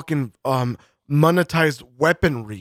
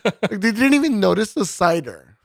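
mocking influencer; 4 semitones higher than usual.

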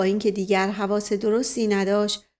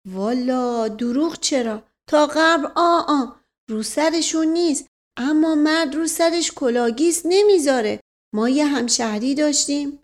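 rambling servant, earnest; 5 semitones higher, 4 dB louder than usual. Acted. Servant reporting on his bald townsman, earnest and raised in pitch. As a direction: play an earnest storytelling manservant. Voices servant Mash Qasem.

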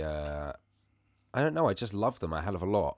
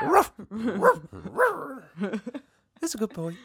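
high yappy ruffs then praise; dog bark; 4 dB louder, much slower than usual. Pretend. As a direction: bark like a pup, then coo.

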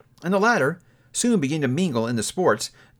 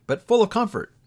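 ordinary delivery, nothing marked

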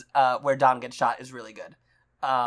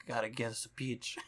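low, very soft mumble; mumbled godfather line; Marlon Brando voice; 5 semitones lower, 15 dB quieter than usual. Acted. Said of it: "Marlon Brando being like".

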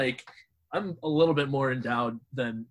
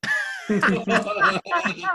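high burst of laughter. Real, not acted. Mocked about team winning rings.

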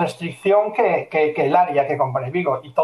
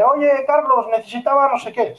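higher, eager client nagging; pushy client voice; 7 semitones higher than usual. Retold or imitated. Retelling a client's message, higher and insistent. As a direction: play the needy client pestering.

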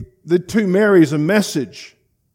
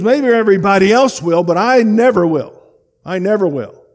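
loud, higher, insisting he never will; insistent protest; 5 semitones higher, 6 dB louder than usual. Retold or imitated. Preacher voicing Peter's loud, insistent vow. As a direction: Peter loudly swearing he'll never fall away.

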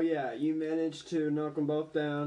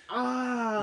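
imitated dying cry; 9 semitones higher, 3 dB louder than usual. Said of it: "the other dude was going".

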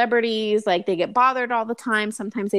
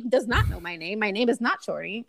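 normal talking voice

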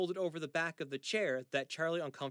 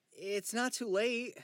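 high, slow pleading; 9 semitones higher, 5 dB louder, slower than usual. Acted. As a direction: beg him to stop. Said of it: "Charlie pleads".

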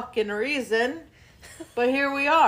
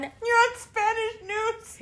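high mock voice; 11 semitones higher, slower than usual. Teasing friend in high voice.

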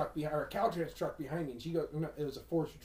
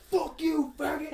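high, loud road-rage shout; retold yell out window; 11 semitones higher, 7 dB louder, slower than usual. Himself raging at another driver, retold loud yell. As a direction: holler it as if out a car window. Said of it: "I'll be out the window like".

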